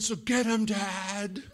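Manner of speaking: high imitated kid voice; high-pitched mimicked plea for payback; child urging Dad. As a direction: egg Dad on like a little kid.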